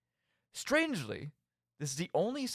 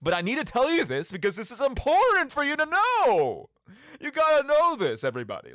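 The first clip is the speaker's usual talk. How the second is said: higher and louder, a raving madman urging everyone; mock madman rant; 10 semitones higher, 6 dB louder than usual. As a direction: play the unhinged prophet who must be heard. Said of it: "mumblings of a madman".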